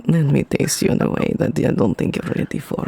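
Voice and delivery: seductive Russian vampire voice, hushed; Russian vampire accent. Acted. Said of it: "I have a Russian one".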